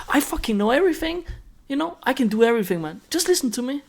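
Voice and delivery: strut it like a cocky veteran showing off; arrogant know-it-all. Retold or imitated. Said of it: "a typical thing could be like".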